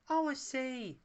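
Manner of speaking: wistful. A narrator's reading. Paella wistfully recalls her balloon. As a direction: say it remembering. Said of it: "looking wistful".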